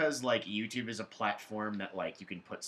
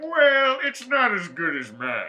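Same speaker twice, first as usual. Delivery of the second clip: higher, louder, slow smug put-down; smug boast; 12 semitones higher, 10 dB louder, slower than usual. Retold or imitated. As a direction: lord it over the bad impressionist. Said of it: "And I was just like".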